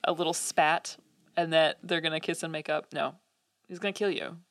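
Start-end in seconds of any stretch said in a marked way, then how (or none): none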